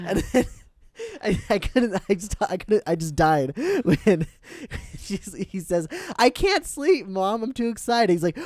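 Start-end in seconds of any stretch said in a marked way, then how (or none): 6.2-8.1 high-pitched mock excited boy; overexcited kid. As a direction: whine it up high to Mom, too thrilled to sleep. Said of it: "He's just excited".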